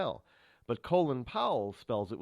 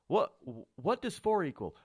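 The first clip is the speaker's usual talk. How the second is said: lightly voiced quizzer, higher pitched; mock classroom question; 6 semitones higher than usual. Host mocks new math lessons, lightly acted questioning.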